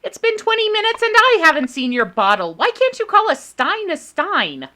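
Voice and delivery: exasperated. Real, not acted. Exasperated mock complaint.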